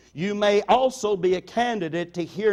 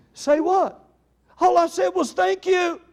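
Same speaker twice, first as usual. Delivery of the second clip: puzzled defensiveness; 10 semitones higher than usual. Retold or imitated. Assistant pastor insisting to the groaning preacher, baffled and higher. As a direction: protest that you did nothing wrong.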